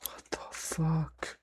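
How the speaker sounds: hushed frightened whisper. Real, not acted. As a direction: breathe it out scared. Scared in dark house.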